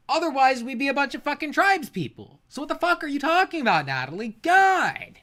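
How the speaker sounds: exasperated rant at Natalie; frustrated rant. Real, not acted. Ranting at Natalie.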